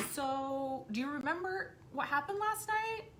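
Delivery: slow, high, tentative question; careful probing. Retold or imitated. Mom asking son about last night, carefully casual. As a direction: ease gingerly into asking a child.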